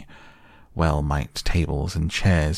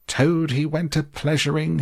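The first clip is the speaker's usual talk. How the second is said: much higher, furious yell on Toad; 10 semitones higher than usual. Acted. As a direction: hurl the weasels' song back at them. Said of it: "he yelled".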